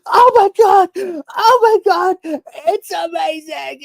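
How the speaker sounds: very high squealed fake excitement, stretched out; hammed-up tearful fangirl squeal; mock gushing fan. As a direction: imitate a trailer reactor overcome to tears. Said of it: "reacting to Star Wars trailers and they're going".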